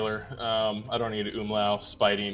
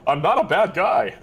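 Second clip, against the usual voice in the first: loud, higher-pitched plea of innocence; mock protest; 7 semitones higher, 5 dB louder than usual. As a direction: protest your innocence, half laughing. Acted protest to the accusing character.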